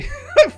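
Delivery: high squealing laugh breaking the act; burst of laughter. Real, not acted. Streamer breaks character laughing at her own voice, squealing laugh. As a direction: cracks up mid-thank-you.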